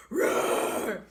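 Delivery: breathy roar to scare the bear; mock scary roar. Wife roaring at bear behind husband, mock roar. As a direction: roar to seem big and scary. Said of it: "trying to be scary".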